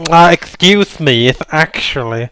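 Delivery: slow nasal know-it-all correction; nerd voice. Retold or imitated. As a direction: correct them like a pedantic nerd. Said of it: "said it in nerd voice".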